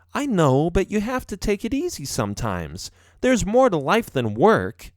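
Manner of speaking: lightly voiced wife urging him to ease up; understanding wife. A narrator's reading. Wife telling professor to take it easy, understanding.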